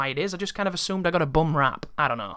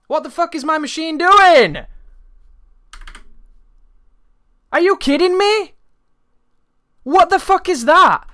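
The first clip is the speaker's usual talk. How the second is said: loud, high, exasperated yelling at the computer; raging at a crashing PC, loud and high; furious outburst; 12 semitones higher, 8 dB louder than usual. Real, not acted. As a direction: shout at the machine in real disbelief. Real frustration at crashing PC.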